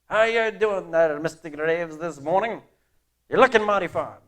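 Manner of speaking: put-on Irish accent. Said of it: "with an accent, an Irish accent".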